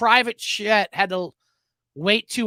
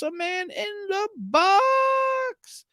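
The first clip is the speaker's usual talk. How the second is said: drawn-out tease; 12 semitones higher, much slower than usual. Acted. Teasing a segment title.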